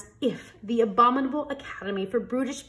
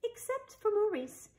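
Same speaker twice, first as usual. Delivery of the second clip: storyteller's lift; 12 semitones higher, 5 dB quieter than usual. A narrator's reading. Maurice stands out.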